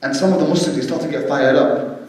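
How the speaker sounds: usual speaking voice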